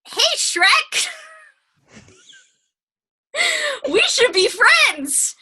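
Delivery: high, slow, excited donkey voice; chirpy sidekick begging to be friends, slow; goofy high Donkey, slowed right down. Acted. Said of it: "Donkey finds Shrek, and he says".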